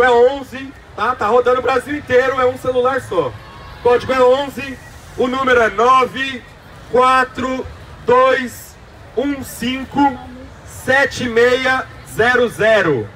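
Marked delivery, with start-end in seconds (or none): 3.3-13.1 digits spaced out one by one, slow; slow, with pauses. Shown as a demonstration. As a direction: dictate each number slowly. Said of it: "vou falar pausadamente".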